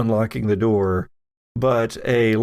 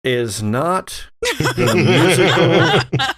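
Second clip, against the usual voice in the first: laughing; 4 semitones higher, 3 dB louder, much slower than usual. Real, not acted.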